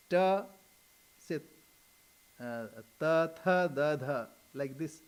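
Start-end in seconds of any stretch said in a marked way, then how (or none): 0.1-0.4 "Ta" alone, loud and distinct; sound demonstration. Shown as a demonstration. Swami showing sounds hard for Western tongues, loud isolated "Ta". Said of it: "it's difficult". 3.0-4.3 ta, tha, da, dha with tongue on the teeth, plain then aspirated; dental consonants. Shown as a demonstration. Swami showing sounds Westerners find difficult, dental ta, tha, da, dha. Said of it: "um, dental".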